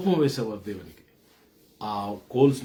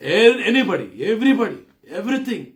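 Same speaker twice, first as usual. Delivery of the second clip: higher and louder, each word a peak; climactic preaching cry; 10 semitones higher, 8 dB louder, slower than usual. Real, not acted. Preacher to congregation, crying out rising list. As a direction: pile them up with rising fire.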